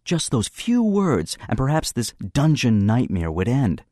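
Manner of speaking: ordinary delivery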